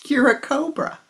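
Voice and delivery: high, loud incredulous objection; blunt refusal. Acted. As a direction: state the obvious, exasperated. Farmer refusing cobra's request.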